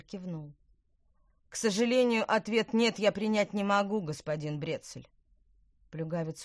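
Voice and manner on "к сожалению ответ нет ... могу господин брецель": loud, high, sneering taunts; a smug villain mocking his victim; taunting villain. A narrator's reading. Villain refuses refusal.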